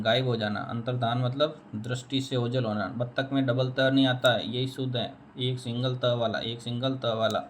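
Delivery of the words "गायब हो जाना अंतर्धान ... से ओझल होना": normal talking voice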